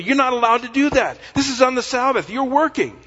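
high, fast-rising scolding objection; mock the outraged rule-keepers, pitched well up; indignant murmuring. Religious leaders objecting to a Sabbath healing, indignant. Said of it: "they began to murmur and say".

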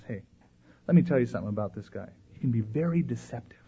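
hushed, low confidential aside; whispered warning. Acted. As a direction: quietly warn a friend in secret. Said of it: "he whispers too".

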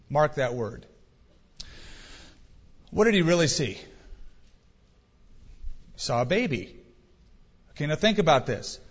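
ordinary talk, nothing marked

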